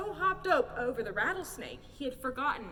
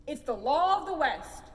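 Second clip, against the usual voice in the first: loud stern proclamation; shouting; 3 semitones higher, 7 dB louder than usual. A narrator's reading. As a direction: bellow out the law. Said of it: "shouted the sheriff".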